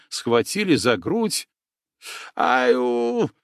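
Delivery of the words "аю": high-pitched yelp of pain; whiny cry of pain. Acted. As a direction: yelp like a wounded man. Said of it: "закричал, законючил масластый".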